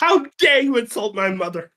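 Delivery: hammed-up indignation; offended cat. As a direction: play the cat scandalized by an insult. Acts the offended cat, laughing.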